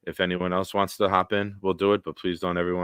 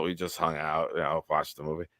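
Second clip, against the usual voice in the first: sheepish mumble; 4 semitones lower, 7 dB quieter than usual. Retold or imitated. Boys answering dad sheepishly.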